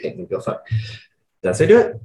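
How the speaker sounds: ordinary delivery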